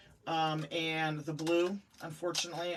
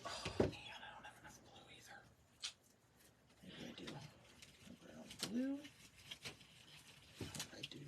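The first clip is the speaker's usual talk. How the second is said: whisper; 7 semitones higher, 12 dB quieter than usual. Real, not acted. Muttering to herself while crafting.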